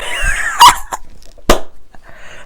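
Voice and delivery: squealing real laugh, very high; high shrieking laugh. Real, not acted. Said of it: "Sorry, that was so loud".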